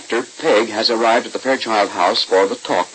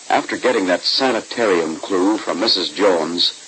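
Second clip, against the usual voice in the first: ordinary talk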